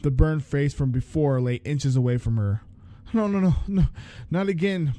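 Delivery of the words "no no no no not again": high panicked pleading; screaming. A narrator's reading. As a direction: beg in terror, pitched high. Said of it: "Blake screamed".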